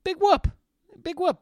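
high scoffing show of toughness; mock bravado. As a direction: brush it off like a tough guy, up high. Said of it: "you sit there and you go".

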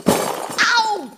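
sudden sharp ow of pain; pained yelp. Acted. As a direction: yelp as if you just got hurt. Bumps into something looking.

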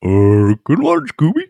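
high, loud cartoon dog voice; Scooby. Answering the co-host's mystery question, a Scooby impression. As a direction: put on a goofy, high-pitched dog impression. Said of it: "that was a great impression".

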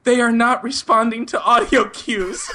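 high, slow, over-formal caller; mock-panicked stilted report, close to laughing; nervous high voice. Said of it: "ten octaves higher than it normally is".

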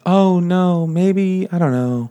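high, drawn-out fake hesitation; coy mock reluctance. Heroine pretending to object to marrying Jamie, coy mock reluctance.

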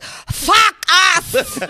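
put-on high, loud Russian-accented fuck us; loud mock Russian accent; Russian accent shout. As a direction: bellow it like the Russian performer. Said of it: "But he's like".

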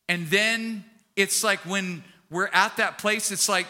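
ordinary delivery

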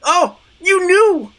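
high, drawn-out melodramatic gasp of recognition; overjoyed revelation. Acted. Damiana learning Jeanette knew all along, high and thrilled. Dolls' melodramatic confession scene.

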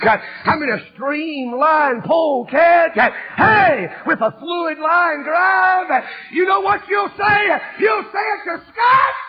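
shouted, high hellfire preaching; full-force revival shout; fiery preaching. Acted. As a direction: preach it hard and loud, slowing on the big words.

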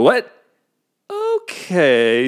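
long, high, loud okay; reluctant acceptance. Acted. Giving in after another surprise, mock reluctant acceptance. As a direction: stretch it out, hammed-up.

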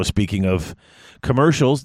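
usual speaking voice

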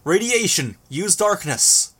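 high, urgent call. A narrator's reading. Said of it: "I raised my voice".

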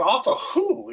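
high, incredulous "off of who"; retold disbelief. Racer asking his mechanic who beat him, stunned. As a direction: ask it like you can't believe it. Retold shock at qualifying time.

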